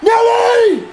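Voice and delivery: loud, high rowdy yells; drunk yelling outside. Acted. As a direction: holler names across the lot. Calling names out loud.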